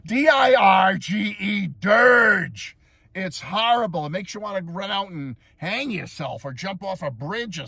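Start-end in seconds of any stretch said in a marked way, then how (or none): 0.1-2.8 slow, higher, shouted spell-out; emphatic spelling. Real, not acted. Reviewer spells out his verdict on the song, emphatic shouted spelling. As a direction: belt each letter, then hit DIRGE.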